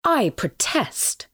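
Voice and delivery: warm exclamation. A narrator's reading. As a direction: protest with warm conviction, slowly. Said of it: "exclaimed Behemoth warmly".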